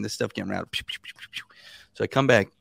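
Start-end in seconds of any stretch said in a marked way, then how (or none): 0.7-1.4 soft unvoiced pew pew zaps; whispered pew noises. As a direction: breathe out quick little pew sounds like shots. Imitating paperwork zipping along.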